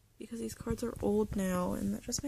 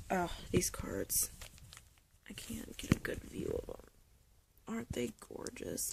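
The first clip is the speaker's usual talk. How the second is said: hushed whisper over gorgeous cards; awed whisper; 8 dB quieter than usual. Real, not acted. Collector whispering in awe over her old cards. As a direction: breathe it out in admiration.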